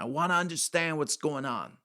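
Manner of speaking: put-on retelling an old man's words in a New York accent. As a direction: repeat it as the old New Yorker. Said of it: "like, New York kind of accent".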